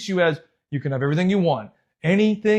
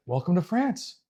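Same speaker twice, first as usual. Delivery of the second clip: soft, offhand mock welcome with a lifted ending; understated imitation, softer and rising at the end; imitating opponent's greeting; 7 dB quieter than usual. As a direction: quietly mimic a cool, taunting welcome.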